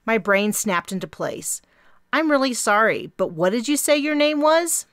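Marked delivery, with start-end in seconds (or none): 2.1-4.8 higher, louder apologetic question. A narrator's reading. Di admits she missed the name, apologetic and raised. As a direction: sheepishly ask her name.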